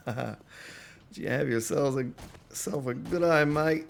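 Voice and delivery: put-on Australian accent. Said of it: "the only thing I can say with an accent, Australian accent".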